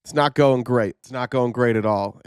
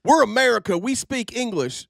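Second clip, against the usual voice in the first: mock American chauvinist; 9 semitones higher than usual. Host mimicking how Americans insist on English, mock indignant. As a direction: put on an indignant, higher-pitched American. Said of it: "How Americans are like".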